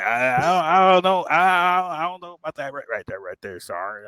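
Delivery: loud, high stammer that drops away; put-on stutter. Trainee hoping to be left alone, faking a stutter. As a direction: trip over each phrase, then fade. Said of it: "if you start stuttering".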